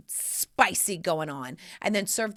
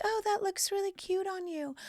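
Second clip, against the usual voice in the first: high, soft compliment; lightly voiced kind hostess; sweet Janet compliment; 12 semitones higher, 5 dB quieter than usual. Janet compliments her guest's sweater, high and soft. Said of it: "She's like".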